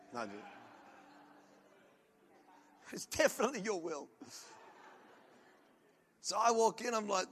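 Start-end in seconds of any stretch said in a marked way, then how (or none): none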